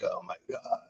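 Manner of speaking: whispered exasperated aside. Retold or imitated. Bored guest whispering to himself as the psychic circles. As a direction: breathe it out under your breath. Inner groan while waiting.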